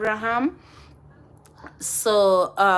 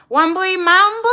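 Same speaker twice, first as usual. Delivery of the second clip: laughing greeting; 13 semitones higher, 5 dB louder than usual. Real, not acted. Teasing a friend by name.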